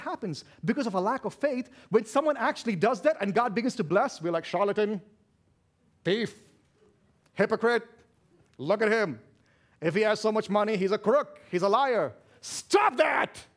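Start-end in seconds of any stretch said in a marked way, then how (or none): 4.4-12.1 loud mock denunciation; mock accusation. Mimics church people accusing the blessed. 12.4-13.4 loud, high shouted command; forceful rebuke. Real, not acted. Preacher rebukes the accusers.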